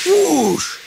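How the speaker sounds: very high hissing whoosh; hissed whoosh. A narrator's reading. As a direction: let out an indignant steam-engine hiss. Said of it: "hissed Toby".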